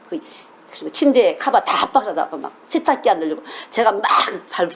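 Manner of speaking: normal talking voice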